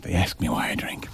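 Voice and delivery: breathy aside whisper. Pretend. Host whispers a joke aside on air. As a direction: whisper it like a confession. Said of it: "unvoiced whisper".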